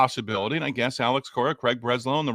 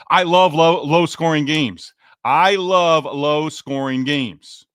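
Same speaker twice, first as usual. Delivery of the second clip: loud, insistent confession, slowing on the repeat; emphatic declaration; 2 semitones higher, 6 dB louder, slower than usual. Real, not acted. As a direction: declare it loudly, stretching out the second time.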